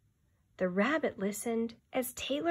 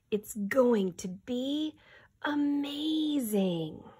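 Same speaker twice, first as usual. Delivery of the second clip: slow, higher, hopeful; a hint of wonder; 5 semitones higher, slower than usual. Taylor telling the rabbit about building again, hopeful.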